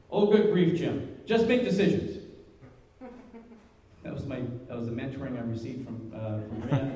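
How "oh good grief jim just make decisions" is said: louder, higher, impatient brush-off; mock-exasperated good grief; exasperated boss. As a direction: wave off his panic like a busy boss. Boss answers his panic.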